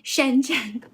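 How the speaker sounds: slow, drawn-out mimicked "Shenzhen"; Westernised pronunciation. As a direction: stretch "Shenzhen" out as a Westerner would. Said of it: "pronounce it in a Western way".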